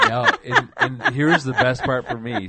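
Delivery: bursting in with a loud high laugh; husband laughing hard, loud and high. Real, not acted. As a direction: talk through the laugh. Husband laughing at wife's story.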